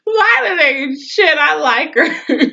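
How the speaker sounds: very high, slow, laughing squeal; real laughing delight. Real, not acted. Speaker relishing the daughter's nerve, laughing. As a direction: stretch it out through laughter. Delighted by Violet's cruel jibe.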